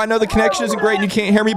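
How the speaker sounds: normal talking voice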